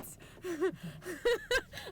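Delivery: high, giddy laugh; giddy laughter. Real, not acted. Overwhelmed by the good news, giggling helplessly. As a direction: laugh out the excitement.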